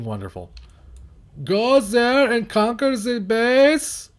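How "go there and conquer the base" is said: high, slow, strained command voice; drawn-out high bark of an order; odd commanding yell. Real, not acted. Gamer barking an order at his units, high and drawn out. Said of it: "Why am I yelling like that?".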